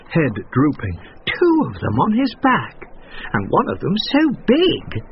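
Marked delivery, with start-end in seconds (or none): 1.3-5.0 higher, indignant woman; indignant friend. A narrator's reading. Woman's friend complaining about the riders. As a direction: tut at the load. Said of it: "said her friend".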